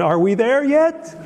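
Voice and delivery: put-on high, rising kid's plea; impatient child. Small child asking parents on a long trip, impatient imitation. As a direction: imitate a child pestering from the back seat. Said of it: "parent of small children traveling on a long trip".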